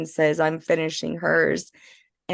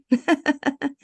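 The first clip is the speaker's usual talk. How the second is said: light laugh; 9 semitones higher than usual. Real, not acted. Laughs at own pun.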